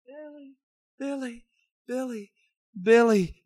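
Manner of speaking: high, loud cries of the name; a trapped mother crying out to her son; desperate cry. Acted. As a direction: cry out for him as the rock falls. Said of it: "she called out one last time".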